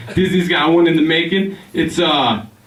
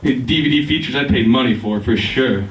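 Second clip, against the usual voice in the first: normal talking voice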